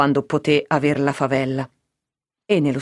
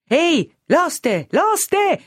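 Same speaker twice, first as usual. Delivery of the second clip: high, drawn-out call for the innkeeper; crying out; 12 semitones higher, much slower than usual. A narrator's reading. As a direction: call out slowly at a soaring pitch, narrator's shout. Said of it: "gridò quindi a quanto fiato aveva in corpo". (grido acuto e prolungato per chiamare l'oste)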